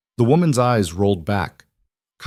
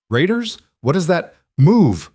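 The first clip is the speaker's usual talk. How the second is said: high startled question breaking into a loud order; alarmed urgency; 9 semitones higher, 4 dB louder than usual. A narrator's reading. Fortress hears of raiders at the south border, alarmed urgency. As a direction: blurt it high, then punch the command.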